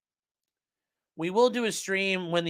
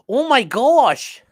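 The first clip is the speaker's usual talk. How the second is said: drawn out, louder and higher, stretched slowly; slow Midwestern oh my gosh; 8 semitones higher, 9 dB louder, much slower than usual. Shown as a demonstration. Streamer showing chat the proper Midwestern oh my gosh, slow and drawn out. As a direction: stretch every word like a Minnesotan mom. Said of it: "you have to be like".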